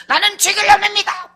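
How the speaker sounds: loud, high hammed-up cry; mock anguished confession. Husband banging head on floor, hammed-up loud remorse. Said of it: "막 울어 제키는 거예요".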